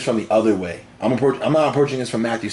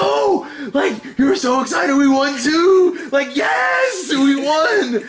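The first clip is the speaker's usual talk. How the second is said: much higher, shouted joy, dragged out slowly; excited shout; much slower than usual. Retold or imitated. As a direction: yell it like you just hit the jackpot. Retelling shouting at his girlfriend.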